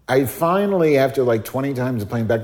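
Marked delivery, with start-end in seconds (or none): none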